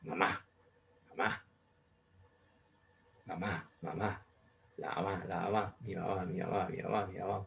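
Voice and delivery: soft, low murmured chanting of mamá; quietly chanted mamá; mock kids' chorus. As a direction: murmur it like many distant kids. Imitates chorus of kids.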